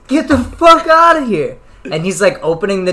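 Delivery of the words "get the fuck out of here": imitating an angry roommate yelling high and loud; retold scream. Roommate finds strangers on his couch, screams at them. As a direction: belt it out like he's throwing strangers out. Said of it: "still screaming".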